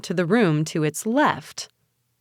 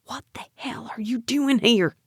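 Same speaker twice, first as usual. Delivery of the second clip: high, hoarsely whispered demand; hushed, urgent confrontation; angry whisper; 6 semitones higher than usual. A narrator's reading. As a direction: whisper it furiously at an intruder. Angry man confronts intruder under breath.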